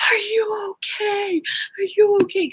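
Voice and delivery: very high, imitating a worried husband; retold alarm. Voices husband alarmed at her fall.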